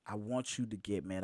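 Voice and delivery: normal talking voice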